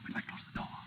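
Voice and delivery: hushed whisper in the dark. Acted. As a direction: breathe it so no one hears. Sneaking into a dark shop.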